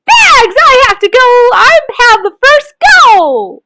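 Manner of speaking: put-on high, loud squabbling kids; children shouting with excitement. A narrator's reading. Children crowding the seesaw: high excited shouts. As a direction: everyone wants the first go. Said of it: "shouting with excitement".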